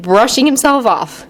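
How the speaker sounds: plain reading voice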